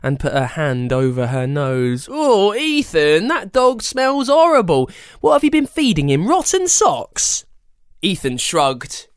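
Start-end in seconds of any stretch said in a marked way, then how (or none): 2.1-7.5 put-on high, loud grossed-out girl; disgusted. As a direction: recoil from the stink. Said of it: "but then gagged".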